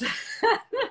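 loud high giggle; amused laugh. Real, not acted. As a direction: burst into laughter. Laughs at own joke.

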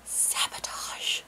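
slow, drawn-out whisper; mock-sinister whisper. Wife jokingly accusing husband over bacon, slow whisper.

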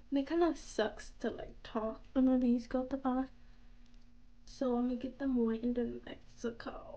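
talking while fitting retainer; speaking with dental appliance; retainer in mouth. Real, not acted. Seen 0: Fits retainer on upper teeth at start; lower one added near end.

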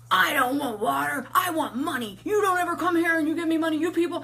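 high, loud demanding tirade; mock angry rant. Homeless woman demanding money from helper, angry rant. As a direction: imitate an irate woman ranting. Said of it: "She goes".

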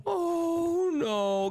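high, long oh no; dismayed. Acted. Child found out by dad, dismayed. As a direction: wail it high in dread.

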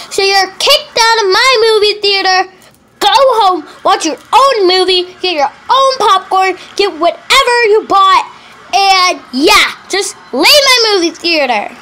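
furious pretend worker, shouting; angry shout. Pretend theater worker shouting at a rude customer to leave.

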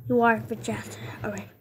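ordinary talk, nothing marked

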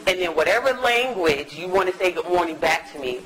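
ordinary talk

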